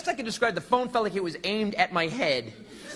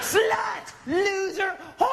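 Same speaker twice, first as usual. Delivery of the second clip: mock yelled insults; much slower than usual. Husband and wife trading insults in a high yelled barrage. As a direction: hurl each word like a punch. Couple hurling insults back and forth.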